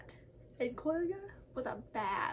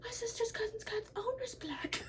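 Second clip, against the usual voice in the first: a mock Karen, higher and half-whispered; 8 semitones higher than usual. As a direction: sneer it like a snooty Karen. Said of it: "racist Karens".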